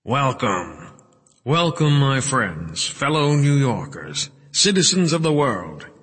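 grand, high-pitched ceremonial welcome; amplified podium speech. A narrator's reading. Wright welcomes the crowd, grand amplified oratory. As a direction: address a big hall through the microphone. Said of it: "Wright's amplified words echoed through the hall".

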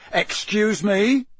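drawn-out, high disbelief; incredulous. A narrator's reading. Mister Diggory questions Harry's claim, incredulous. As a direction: stretch the question upward, incredulous. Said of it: "said Mister Diggory incredulously".

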